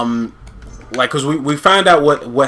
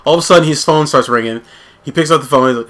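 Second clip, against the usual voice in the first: ordinary talk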